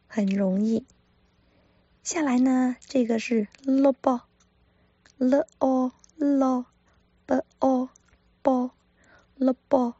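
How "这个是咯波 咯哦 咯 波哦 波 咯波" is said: 咯 light, 波 stressed. Shown as a demonstration. Teacher showing where the stress falls, 波 stressed. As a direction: glide over 咯, land on 波. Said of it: "重音在波".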